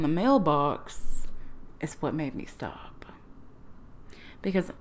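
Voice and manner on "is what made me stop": dramatic whisper. Real, not acted. Dramatic reveal of the mailbox.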